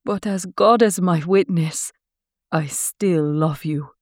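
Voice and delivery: lightly voiced dramatic oath; solemn vow. A narrator's reading. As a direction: swear it like a film heroine. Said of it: "Laura declared".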